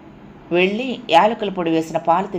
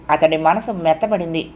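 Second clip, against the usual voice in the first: ordinary delivery, nothing marked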